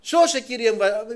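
desperate woman crying out to the king; woman's plea. Acted. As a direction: beg the king for help.

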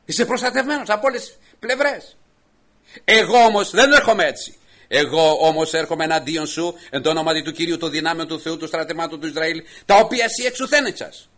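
loud, ringing, high defiance hurled at a giant; defiant proclamation. Acted. David defying the Philistine, a loud preached challenge. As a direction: shout the challenge with full faith. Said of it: "και είπε ο Δαβίδ προς τον Φιλιστέον".

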